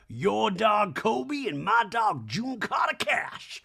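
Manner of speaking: high, slowed, shouted gushing introduction; mock gushing. [name] introducing her dog to a friend, hammed-up shouted gush. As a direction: call it out like a proud dog mom.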